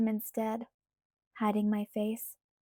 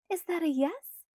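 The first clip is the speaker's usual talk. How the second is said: put-on light, higher girlish pleading voice; Alice's voice; 7 semitones higher than usual. A narrator's reading. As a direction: voice Alice higher, coaxing Bella. Alice hopeful.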